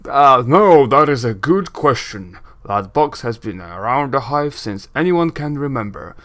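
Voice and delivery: projected, blustery lore-teller; booming voice. Acted. Braskin shares rumors about the box, booming. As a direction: spin out rumors in a big booming voice. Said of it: "doing the booming voice".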